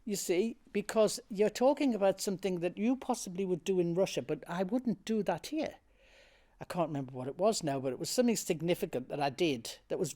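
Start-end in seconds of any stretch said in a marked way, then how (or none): 0.0-5.8 raised, insistent arguing back; indignant retort. Retold or imitated. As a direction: retell standing your ground, pitched up. Acted argument with director.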